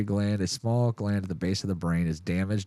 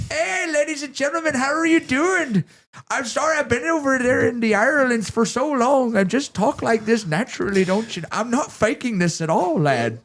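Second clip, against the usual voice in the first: much higher, hammed-up Irish pub greeting; Irish accent; 14 semitones higher, 3 dB louder than usual. Greeting the bar on the first night, mock Irish accent. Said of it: "your heritage is coming out".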